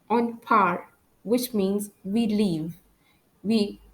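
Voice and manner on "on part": French "part" with the final T silent. Shown as a demonstration. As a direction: end "part" on the R, dropping the T. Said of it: "I don't pronounce the letter T".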